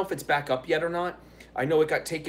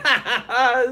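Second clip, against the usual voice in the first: big laugh; 8 semitones higher, 8 dB louder than usual. Real, not acted. Laughs at troll donation.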